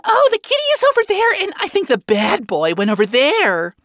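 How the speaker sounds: high little manic voice, rushed at first; scatterbrained. A narrator's reading. Manic tiny Cyclops pointing out her friends, scatterbrained. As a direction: answer with your mind darting about. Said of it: "albeit scatterbrained answer".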